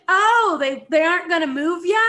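loud, high mocking question; snarky.

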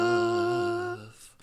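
a squeaky high little woof; high dog woof. Pretend. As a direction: bark it like a tiny dog. Barking like a dog.

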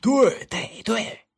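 high, loud chorus of assent; loud agreement. A narrator's reading. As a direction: call out eager agreement as a group. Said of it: "他们大声说道".

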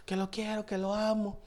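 soft, breathy-free hushed imitation of a smitten teenager; quiet mock teen girl pleading; lovesick teen girl. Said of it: "tenía quince años".

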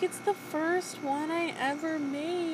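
a light imitation of giddy pride; mock excited. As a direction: stretch it out, thrilled. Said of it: "I'm so excited".

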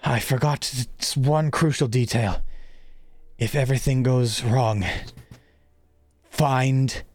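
lightly voiced old headmaster; a hint of an urgent mentor. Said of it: "he says".

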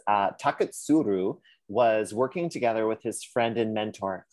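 normal talking voice

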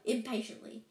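ordinary delivery

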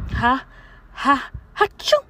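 high loud sneeze build; big sneeze. Acted. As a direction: sneeze from fluffy fur. Fur tickled nose, sneeze.

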